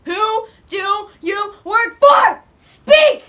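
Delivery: shrieking high furious demand; screaming interrogator. Acted. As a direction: scream it at the traitor. Interrogation erupts into yelling.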